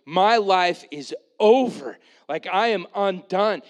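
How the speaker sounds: loud, slow cry of despair; despairing jailer. Acted. Jailer seeing the open doors, loud despairing cry. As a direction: wail that it's all over.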